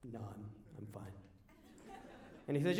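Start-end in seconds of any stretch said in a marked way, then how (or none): 0.1-1.1 low, very soft, hesitant reply; small, low-voiced polite no; quiet refusal. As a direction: decline meekly, almost under the voice. Teen declining dad's hunting invitation.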